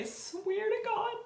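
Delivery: high, tear-strained vow; choking up. Real, not acted. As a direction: voice cracking as the tears come. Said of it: "I'm sorry to get emotional".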